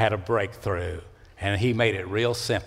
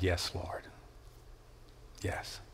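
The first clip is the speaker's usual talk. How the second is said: hushed surrender; 4 semitones lower, 14 dB quieter, slower than usual. Acted. Elijah answers God softly.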